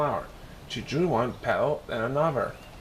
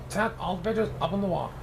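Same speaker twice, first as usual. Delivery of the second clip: usual speaking voice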